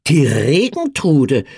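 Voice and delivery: slow, hard repetition of Regentrude with a falling tune; a mocking echo of Regentrude. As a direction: spit Regentrude back, scornful. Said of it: "wiederholte der Bauer hart".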